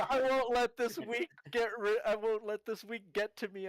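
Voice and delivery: high and loud, giggling through it; laughing. Acted. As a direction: laugh while declaring it. Player acts character, laughing.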